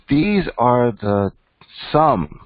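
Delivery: "some" set apart, high and falling; slow lead-in, then punch "some" high; stress on some. Real, not acted. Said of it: "let me emphasize some".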